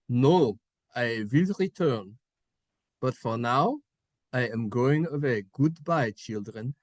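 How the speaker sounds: put-on solemn father with German accent. Father leaving his children, measured German-accented farewell.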